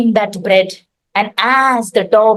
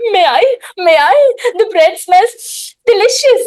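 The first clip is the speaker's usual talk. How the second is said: eager piggy voice; 7 semitones higher, faster than usual. Acted. Pig asks eagerly.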